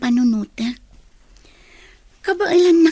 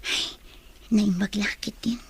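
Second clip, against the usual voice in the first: softer aside under breath; whispered aside; 3 semitones lower, 7 dB quieter than usual. Acted. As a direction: keep it to herself, hushed. Hiding her thoughts. (sibabassit nga ayug)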